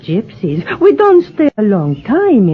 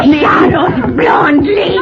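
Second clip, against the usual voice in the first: menacing shout; 9 semitones higher than usual. Acted. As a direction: scream it at her as you strike.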